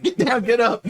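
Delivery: high, laughing confusion; laughing guard. Acted. Guard confused by orders, laughing. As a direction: say it through a laugh. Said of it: "They're like".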